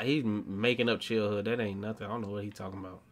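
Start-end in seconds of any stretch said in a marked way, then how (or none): none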